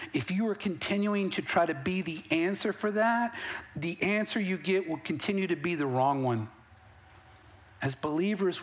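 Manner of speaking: usual speaking voice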